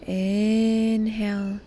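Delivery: "inhale" stretched slowly, swelling on its first syllable; drawn-out breathing cue. Shown as a demonstration. Guide pacing the listener's inhale, drawn-out breathing cue.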